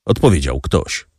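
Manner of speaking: ordinary delivery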